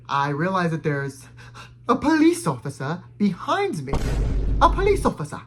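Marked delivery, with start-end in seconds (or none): 1.9-5.4 mock shock. Storyteller reliving a police car appearing behind, shocked. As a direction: swoop up high in theatrical alarm.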